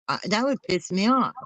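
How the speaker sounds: ordinary talk, nothing marked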